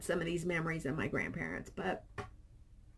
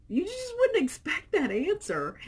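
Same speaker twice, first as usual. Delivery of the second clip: mock surprised; 12 semitones higher, 5 dB louder than usual. Husband's surprised remark.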